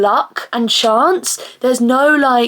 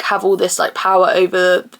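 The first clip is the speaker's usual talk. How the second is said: usual speaking voice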